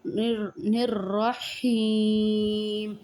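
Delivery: whispered, very slow Rahim, with the ی held long; teacher demonstrating lengthening at a stop; whispered recitation demo. Shown as a demonstration. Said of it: "اس کو چار الف کے برابر کھینچ کر پڑھا جاتا ہے".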